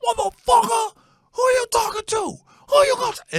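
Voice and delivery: high, loud mock fighting shout; mad stepdad. Son imitating stepdad barreling out the front door, mad high shouting. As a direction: holler it like a hammed-up angry challenger. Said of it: "he talked like a brother".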